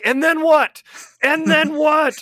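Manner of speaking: high, excited pleading listeners; mock listeners. Listeners begging to hear what happens next, in a high squeak. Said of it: "our listeners were like".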